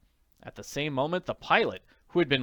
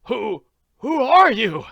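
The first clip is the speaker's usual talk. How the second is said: frightened stammer, higher and slow; 11 semitones higher, 13 dB louder, slower than usual. Acted. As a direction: stumble over the words, scared and high. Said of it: "he stammered".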